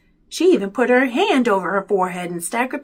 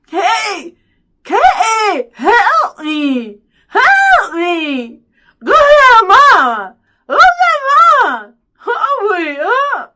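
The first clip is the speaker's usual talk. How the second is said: high, loud garbled wailing; mouth-full mumbled yell; 12 semitones higher, 10 dB louder, much slower than usual. A narrator's reading. Byron begs for help with lips stuck, muffled desperate shouting. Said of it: "sounding like he had something in his mouth".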